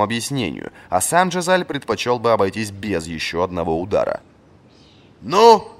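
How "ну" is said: high, loud sharp bark; barked in the face. A narrator's reading. Old master barking at the silent captain. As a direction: bark it right in his face. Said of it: "гаркнул ему в лицо Варус".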